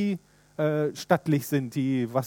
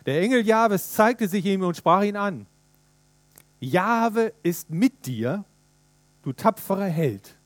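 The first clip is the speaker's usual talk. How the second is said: scripture reading; 3 semitones higher than usual. Acted. Reads Bible verse aloud.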